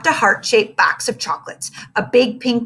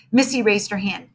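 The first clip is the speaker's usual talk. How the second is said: ordinary delivery, nothing marked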